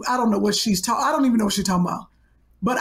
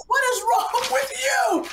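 mock outrage; 11 semitones higher than usual. Real, not acted. Co-host wrote on her email.

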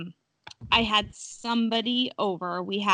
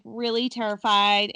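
ordinary talk

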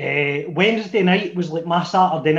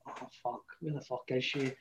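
softer, hushed and worried; hushed nervous thought; 4 semitones lower, 17 dB quieter than usual. Retold or imitated. Checking his watch for the late date, hushed worried mutter. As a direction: mutter it anxiously to yourself.

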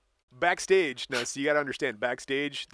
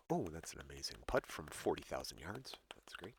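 hushed, low praise for a putt; restrained golf announcer; 8 semitones lower, 14 dB quieter than usual. Acted. Praising an amazing putt on air, restrained golf announcer. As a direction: keep the amazement barely audible. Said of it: "how they can restrain themselves".

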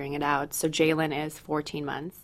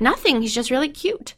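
lightly imitated small child, high and louder at the start; a hint of an innocent preschooler, pitched up; sweet little kid; 8 semitones higher, 3 dB louder than usual. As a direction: mimic a child's innocent answer. Voicing four-year-old daughter.

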